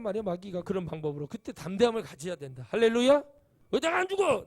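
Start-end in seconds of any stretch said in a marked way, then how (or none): 2.7-3.2 loud high cry of 할렐루야; 할렐루야 crying out full-voiced; shouted hallelujah. Real, not acted. Preacher calling out to congregation. 3.7-4.4 high, loud defiant cry; shouting 왜 내가 안 죽어 in defiance. Acted. Defiant inner voice acted out.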